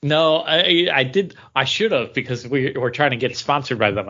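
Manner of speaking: ordinary talk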